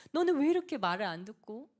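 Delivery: a touch of an irritated parent scolding; mock scolding. Acted complaint at a family member. (꾸짖는 말투 흉내)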